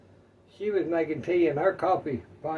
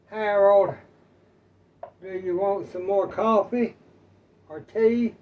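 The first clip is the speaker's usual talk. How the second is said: loud, raised call to her husband; wife calling out; 4 semitones higher, 8 dB louder than usual. Acted. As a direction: call across the table to a forgetful husband. Joke, wife asks forgetful husband.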